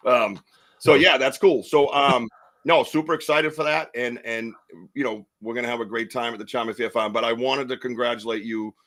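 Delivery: ordinary delivery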